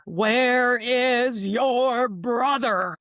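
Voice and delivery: big, booming, slow call from behind; high, loud, drawn-out man's shout. Retold or imitated. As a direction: call it out slow and strong like an unseen man. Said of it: "very loud, with a lot of energy".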